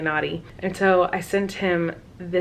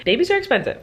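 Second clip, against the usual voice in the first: exclaimed; 10 semitones higher, 3 dB louder, faster than usual. Real, not acted. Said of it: "Just know that".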